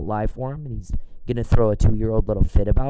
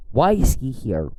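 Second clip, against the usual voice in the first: usual speaking voice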